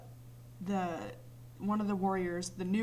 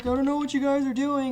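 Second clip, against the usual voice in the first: higher, louder dopey voice; mock clueless player; 6 semitones higher, 8 dB louder, faster than usual. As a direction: play him dazed and oblivious. Mocking clueless teammate.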